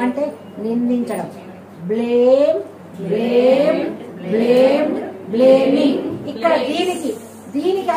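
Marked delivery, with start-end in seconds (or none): 1.9-6.0 loud drill. Shown as a demonstration. Teacher drills verb forms.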